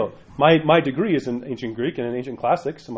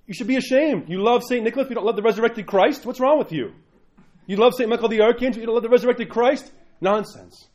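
higher mock scolding; scolding priest; 9 semitones higher than usual. Pastor voices the priest scolding Saint-day-only churchgoers, higher. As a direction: rebuke them with disbelief. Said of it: "And he says".

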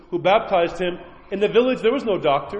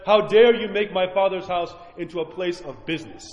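ordinary talk, nothing marked

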